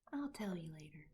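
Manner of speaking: quiet whisper; girl whispering a secret promise; soft reply. A narrator's reading. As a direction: hold back a smile, softly. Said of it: "Sophie said".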